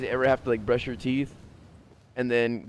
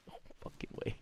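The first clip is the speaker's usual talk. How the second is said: whispered disbelief; 17 dB quieter, faster than usual. Real, not acted. Disbelief at ripped couches.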